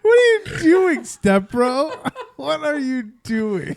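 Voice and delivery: high, playful outcry with laughter; hammed-up cry breaking into laughs; mock incredulous. Joking outcry at choking cohost.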